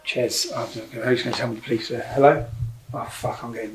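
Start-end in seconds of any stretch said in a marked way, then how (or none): none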